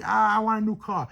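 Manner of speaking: put-on high, loud complaining old lady; demanding lady.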